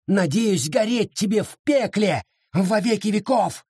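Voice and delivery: high, slow, anguished cursing. Acted. Sara curses Abita Williams, slow and high with fury. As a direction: spit the curse out slowly, pitch high.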